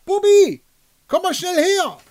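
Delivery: host calling his buddy over through the crowd. Acted. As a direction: shout for a friend across the noisy pub. Calling a friend across pub.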